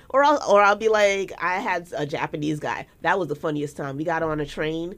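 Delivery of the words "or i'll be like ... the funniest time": usual speaking voice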